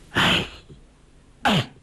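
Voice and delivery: heavy double sigh; two weary sighs with a pause between. A narrator's reading. Blind master sighs before his disciple, heavily. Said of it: "柯镇恶叹道".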